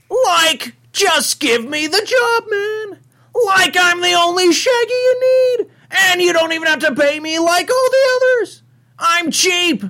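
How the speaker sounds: very high, strained cartoon slacker voice; hammed-up high cartoon sidekick; Shaggy. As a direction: plead for the job in a high cartoon yelp. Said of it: "I have to hear the Shaggy".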